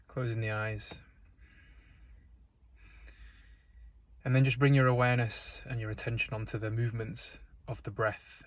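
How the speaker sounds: calm, unhurried meditation voice with long pauses; guided meditation. Real, not acted. Guide leading listeners into breath and stillness, calm meditation delivery. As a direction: guide them gently, leaving space between phrases.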